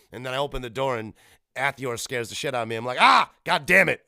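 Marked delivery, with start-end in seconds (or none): 3.0-4.0 loud, high yelp turning into cursing; startled yell. Retold or imitated. Player scared by a prank at the door, startled yell. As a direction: react to a jump scare, imitated.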